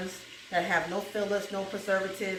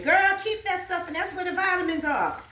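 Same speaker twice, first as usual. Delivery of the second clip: mock scolding auntie, pitched higher; a higher, scolding elder's voice; old school elder; 7 semitones higher than usual. Auntie calling from the kitchen, mock scolding. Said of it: "I can hear the old school saying".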